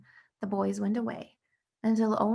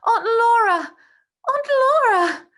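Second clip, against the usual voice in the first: much higher, louder delighted calling; boy greeting his aunt with a joyful shout; joyful cry; 14 semitones higher, 12 dB louder than usual. Acted. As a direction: cry out her name in glee.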